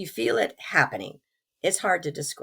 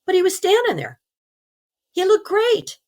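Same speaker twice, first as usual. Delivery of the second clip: high, louder exclamation of wonder; delighted amazement; 13 semitones higher, 6 dB louder than usual. Real, not acted. Telling how her sick friend looked great, delighted and amazed. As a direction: marvel out loud at the sight.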